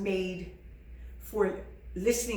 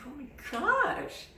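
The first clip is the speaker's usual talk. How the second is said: drawn-out oh my gosh; 7 semitones higher, slower than usual. Retold or imitated. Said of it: "It's like".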